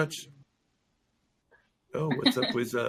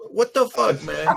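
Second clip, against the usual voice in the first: mock outrage; 3 semitones higher, slower than usual. Retelling reaction to the water mix-up, mock exasperation. Acted outrage at being misunderstood.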